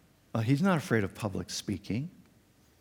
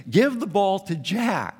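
higher, louder slow yell from the sidelines; coach yelling; 9 semitones higher, 6 dB louder, slower than usual. Retold or imitated. Coach yelling to his losing team, mock shout. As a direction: call across the field. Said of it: "the coach yelled again".

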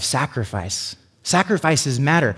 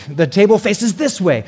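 mock bossy wife; 6 semitones higher, 5 dB louder than usual. Wife's furniture rule voiced.

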